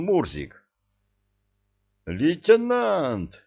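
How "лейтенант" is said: drawn-out high call; slow glad call. Acted. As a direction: call out gladly. Calling the lieutenant.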